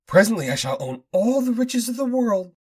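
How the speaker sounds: high crackly villain gloat; gloating old inventor. Acted. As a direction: gloat over the world's riches. Fortock gloats.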